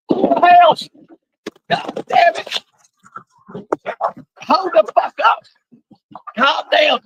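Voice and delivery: high, urgent real shouts to break up a fight; cursing at the top of the voice; frantic angry shouting. Real, not acted. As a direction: yell over fighting dogs. Breaking up a sudden dog fight.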